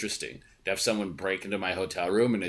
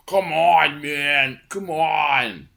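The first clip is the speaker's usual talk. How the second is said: higher, louder whiny "come on"; loud mock pleading; pleading fans; 5 semitones higher, 7 dB louder, much slower than usual. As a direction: beg like pushy autograph hunters. Signature hunters pleading.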